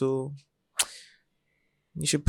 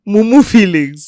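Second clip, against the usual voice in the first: laughing; 6 semitones higher, 9 dB louder than usual. Real, not acted. Laughing repeat.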